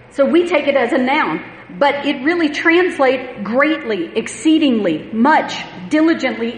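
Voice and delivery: loud, driving preacher voice; fervent pulpit delivery; preaching at full force. Acted. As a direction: preach it out to the congregation.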